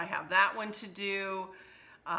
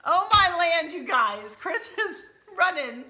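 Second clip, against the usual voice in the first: laughing; 11 semitones higher, 9 dB louder than usual. Real, not acted. Cracking up at husband running.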